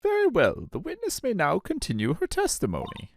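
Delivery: put-on high, fussy old judge. Player voicing the judge.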